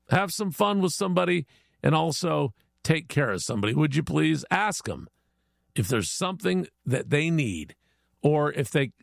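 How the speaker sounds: usual speaking voice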